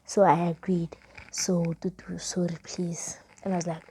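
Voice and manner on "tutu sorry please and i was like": ordinary talk, nothing marked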